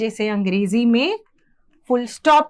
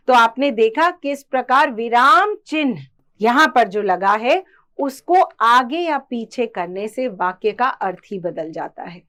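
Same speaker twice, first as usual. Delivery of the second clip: usual speaking voice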